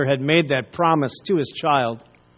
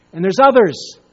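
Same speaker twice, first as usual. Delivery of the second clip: ordinary talk, nothing marked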